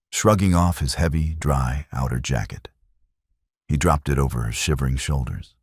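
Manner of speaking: usual speaking voice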